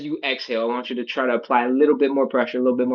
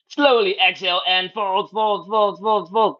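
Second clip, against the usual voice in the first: strained mid-stretch; 7 semitones higher than usual. Real, not acted. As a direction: talk through the strain of the fold. Instructor folding deep into stretch.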